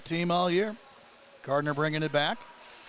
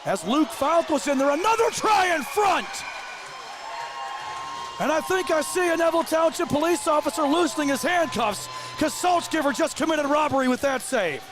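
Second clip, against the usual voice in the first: excited play-by-play; 8 semitones higher than usual. Real, not acted. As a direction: build the call as the save comes. Goal-mouth scramble called live.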